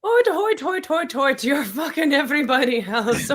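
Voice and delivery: high-pitched comic mimicry, dropping toward the end; mocking character quote. Guest quoting a weird movie love interest, mocking mimicry. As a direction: send up the line in a high silly voice.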